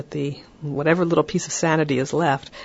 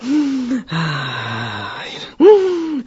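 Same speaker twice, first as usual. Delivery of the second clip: loud slow breathing; 7 semitones higher, 5 dB louder than usual. Shown as a demonstration. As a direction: demonstrate a long, loud calming breath. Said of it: "breathe loudly and slowly".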